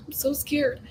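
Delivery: whispered, sighing, lying frightened in bed; scared whisper. Retold or imitated. Remembering a scary night as a kid, a frightened whisper. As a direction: breathe it out like a scared kid in the dark.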